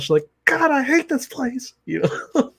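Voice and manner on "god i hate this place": much higher, slow mock outburst; mock yell. Girl at the alligator farm mock yelling at her family business. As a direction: blurt it out high and drawn out. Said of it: "randomly turns around and yells".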